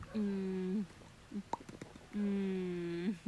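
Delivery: whisper-soft as if not to wake her. Real, not acted. Murmuring softly to the baby.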